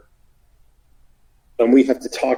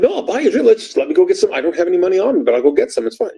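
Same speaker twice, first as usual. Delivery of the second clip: pitched way up, pleading and flustered; flustered high protest; 7 semitones higher, faster than usual. Retold or imitated. To the ex-hooker demanding a drink, a high flustered protest. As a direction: talk fast and high to calm her down.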